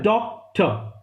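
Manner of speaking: Doctor split by a clear pause, डॉक, टर; syllable-by-syllable demo. Shown as a demonstration. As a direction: break doctor after डॉक to count two syllables. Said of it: "मुंह दो बार हिलेगा". (Doctor दो अलग syllable में, बीच में रुककर)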